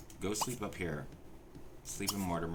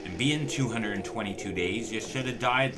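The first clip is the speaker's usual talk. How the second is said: higher, louder frustrated tirade; exasperated rant; 4 semitones higher, 6 dB louder than usual. Real, not acted. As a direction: vent at the Sim who refuses to die. Ranting at the undying Sim.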